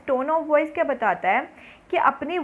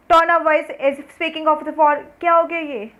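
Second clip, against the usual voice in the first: loud, slow and rude, higher and louder; rude harsh tone; 5 semitones higher, 7 dB louder, slower than usual. Shown as a demonstration. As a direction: bark the words at the listener rudely, dragging them out. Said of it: "और मैं ऐसे बात करूँ".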